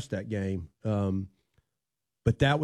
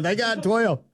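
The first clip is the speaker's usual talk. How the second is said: high frantic repeated cry; excited yelling; 9 semitones higher, slower than usual. Retold or imitated. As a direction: yell it again like a frantic coach. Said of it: "I'm going".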